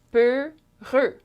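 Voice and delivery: "Peureux" split in two, closed eu in each; syllable breakdown. Shown as a demonstration. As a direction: separate both syllables of "Peureux" with a gap. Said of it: "two syllables".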